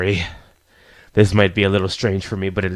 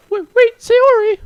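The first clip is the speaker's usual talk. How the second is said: put-on very high, louder girlish protest; slow, high-pitched anime girl caught off guard; flustered shy girl; 7 dB louder, slower than usual. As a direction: squeak out an embarrassed protest. Voices a flustered game girl.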